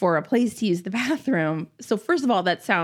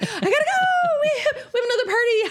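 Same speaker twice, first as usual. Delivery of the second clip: rushed excuse; 14 semitones higher, much faster than usual. Retold or imitated. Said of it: "Like, and just".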